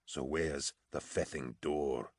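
slow, very soft and low; low whisper. A narrator's reading. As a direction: murmur it slowly, spacing the words. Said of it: "in a low whisper".

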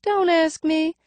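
high nasal voice, faint lisp. A narrator's reading. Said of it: "Her voice is higher ... nasal cast".

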